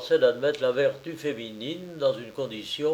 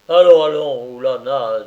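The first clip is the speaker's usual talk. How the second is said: louder, coaxing and confused; flustered, louder nobleman; 10 dB louder than usual. A narrator's reading. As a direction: urge her on, a bit thrown. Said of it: "tout confus".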